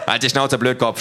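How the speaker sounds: loud angry shout; screaming. A narrator's reading. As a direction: snap at him loudly. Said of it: "schreit der Dicke".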